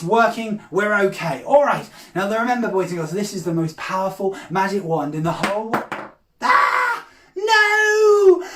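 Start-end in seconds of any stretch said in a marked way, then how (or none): 6.4-8.4 high, loud drawn-out cry of dismay; mock horror. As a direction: wail theatrically as your trick falls apart. Wand falls apart, mock dismay.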